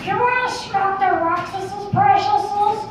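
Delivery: high, drawn-out creature voice; creepy high creature wheedling; Gollum. Acted. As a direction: strain it up high and slow. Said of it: "that's Gollum from Lord of the Rings".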